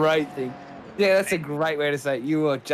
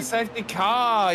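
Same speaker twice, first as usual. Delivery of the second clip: excited; 7 semitones higher than usual. Real, not acted. Safety car called live.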